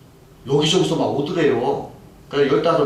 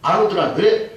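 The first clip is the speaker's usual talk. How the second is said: ordinary talk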